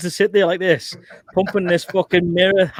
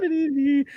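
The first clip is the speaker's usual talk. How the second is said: mock marching hum; 11 semitones higher than usual. Recruit marching to RSM office.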